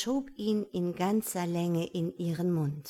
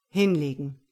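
low, loud order; grinding command; 5 semitones lower, 8 dB louder than usual. A narrator's reading. Man orders woman down, grinding and commanding. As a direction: order it through gritted teeth. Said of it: "knirschte er".

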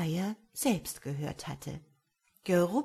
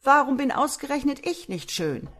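wailing lament; 3 semitones higher, 6 dB louder than usual. A narrator's reading. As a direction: whine about being ugly. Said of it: "Er jammerte und haderte".

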